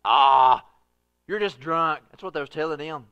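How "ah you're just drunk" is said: loud dismissive scoffing heckler; mocking scoff. Preacher imitating a scoffer mocking believers, loud scoff. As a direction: sneer them down with a loud "Ah". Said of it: "you got somebody go".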